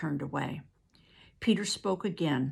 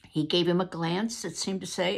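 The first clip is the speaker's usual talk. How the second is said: ordinary delivery, nothing marked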